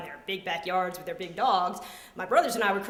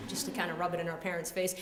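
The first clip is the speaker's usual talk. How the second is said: ordinary delivery, nothing marked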